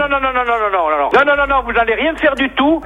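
indignant refusal. Real, not acted. Angry refusal of fake subscription.

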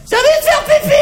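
put-on very high, loud girly shout; mock girlfriend. Girlfriend on a hike calls out she needs to pee, high squeal. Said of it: "elle me fait".

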